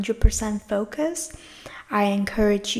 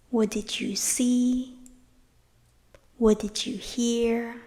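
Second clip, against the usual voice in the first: unhurried meditation guide, leaving space after each phrase; guided meditation. Real, not acted. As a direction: lead them gently inward, phrase by phrase.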